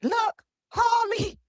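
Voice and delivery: high, drawn-out put-on matron voice; Frederica Wilson. Host attempts a congresswoman's voice on request, high drawn-out impression. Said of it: "Let me hear this voice".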